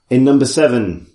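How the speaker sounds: ordinary delivery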